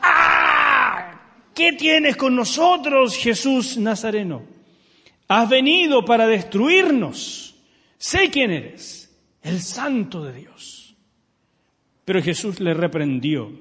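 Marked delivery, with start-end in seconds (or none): 0.0-1.2 high, loud shouted cry with a strange voice; full-throated possessed yell; demon shriek. Shown as a demonstration. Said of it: "no, es". 1.5-10.9 high, loud, slow possessed voice, fading at the end; a cornered demon crying out at Jesus; a strained, strange demon voice that sinks to a hiss; shouting demon. Acted. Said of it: "Y ahí póngale color".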